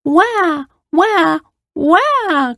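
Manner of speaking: put-on high-pitched little girl wail; mock crying. A narrator's reading. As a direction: imitate a child's wah-wah cry. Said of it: "cried the girl".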